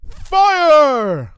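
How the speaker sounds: high, loud command shout. A narrator's reading. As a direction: yell the order to fire. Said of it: "Lyra shouted".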